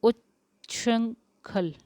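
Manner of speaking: उच्छृंखल stretched out syllable by syllable; teacher dictating उच्छृंखल slowly; slow careful spelling. Shown as a demonstration. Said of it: "शुद्ध वर्तनी वाला शब्द".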